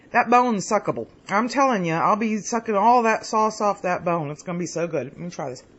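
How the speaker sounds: usual speaking voice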